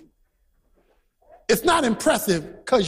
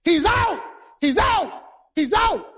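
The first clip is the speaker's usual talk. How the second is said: shouting out; 8 semitones higher than usual. Retold or imitated. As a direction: protest like the visiting team. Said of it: "And they're shouting".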